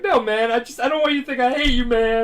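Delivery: high, loud, hammed-up tearful protest; mock crying. Crying boy insisting to his friend, mock tearful. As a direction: plead with a friend in exaggerated sobby pitch. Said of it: "and they're crying".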